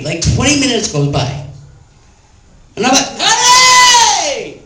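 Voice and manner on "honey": high, loud call across the house. Retold or imitated. Tied-up boyfriend calling downstairs to girlfriend, loud high call. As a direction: yell for her from upstairs.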